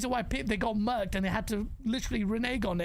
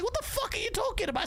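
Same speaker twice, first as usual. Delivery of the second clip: high-pitched, incredulous outrage; retold angry comeback; 10 semitones higher than usual. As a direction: fire back in high disbelief. Retells his angry comeback.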